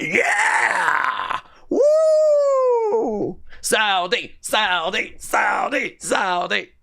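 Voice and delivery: high, whooping fan chant; mock crowd cheering. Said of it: "I can't imagine they'd be like".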